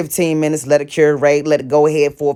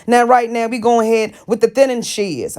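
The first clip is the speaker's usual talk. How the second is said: normal talking voice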